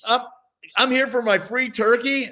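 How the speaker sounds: mock-excited announcement of a free turkey; eager rookie. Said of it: "I'm like".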